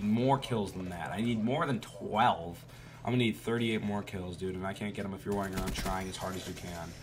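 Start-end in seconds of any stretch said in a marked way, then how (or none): none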